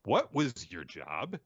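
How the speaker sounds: slow, pointed mock reprimand; drawn-out acted telling-off; emphatic scolding. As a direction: repeat it slowly, leaning on WHAT and JOB. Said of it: "scolding Naroya and says".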